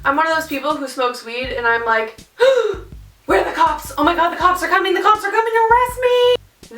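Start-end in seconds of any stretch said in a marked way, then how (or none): 2.4-3.0 sharp, high-pitched gasp; quick theatrical intake of breath; panicked gasp. Retold or imitated. Acts out paranoid panic when high. 3.3-6.8 rising, hammed-up paranoid panic; acting out a paranoid freak-out, pitch soaring by the end; mock panic. Acts out paranoid panic when high.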